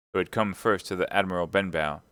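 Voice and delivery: usual speaking voice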